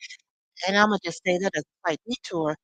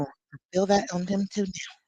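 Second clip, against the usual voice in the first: ordinary delivery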